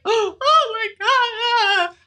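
high, slow hammed-up crying; mock sob. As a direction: act out sobbing, drawn out. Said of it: "I just got like, I cried".

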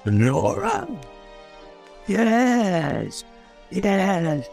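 subdued recognition. Acted. Ghoul recalls Nora.

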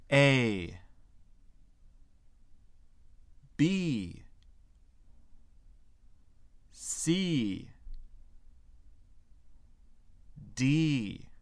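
high, drawn-out letters for repeating; teacher modelling the alphabet, letter by letter; letter-by-letter model. Shown as a demonstration. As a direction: say each English letter alone, high and clear, long falling vowel. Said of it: "Vous allez répéter après moi pour chaque lettre".

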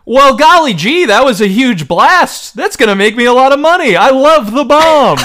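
put-on high, loud gee-whiz kid voice; golly-gee wholesome kid; wholesome 1950s kid.

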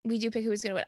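ordinary talk

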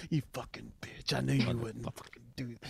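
quiet mock menace; mock bully voice. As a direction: hiss it like a cruel inner voice. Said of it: "lying in bed tonight".

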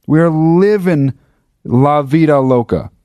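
high, drawn-out mock triumph; hammed-up elation. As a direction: keep stretching the words in overblown glee. Exaggerated triumph continues.

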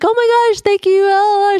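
high-pitched, elated imitation; thrilled daughter. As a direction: gush like an overjoyed winner. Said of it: "she's having the greatest time".

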